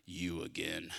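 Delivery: very low, quiet, weary-of-you grumble; frustrated with spouse. Acted. Spouse walks in the room, low frustrated grumble. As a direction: drop it right down, under your breath. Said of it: "that frustrated in marriage".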